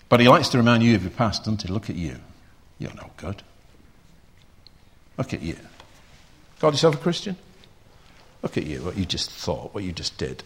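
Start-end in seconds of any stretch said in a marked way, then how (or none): 1.7-2.2 low, quiet sneering accuser; taunting Satan. Acted. Preacher voicing Satan, low quiet sneering taunt. 2.8-3.4 breathy whispered put-down; whispered taunt. Acted. Preacher as Satan, whispering you're worthless. 5.2-10.4 low, quiet sneering accuser; taunting Satan. Acted. Preacher voicing Satan, low quiet sneering taunt.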